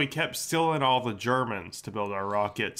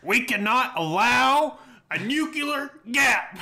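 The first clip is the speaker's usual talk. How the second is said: mock general voice; 10 semitones higher, slower than usual. Quoting Dr. Strangelove line jokingly.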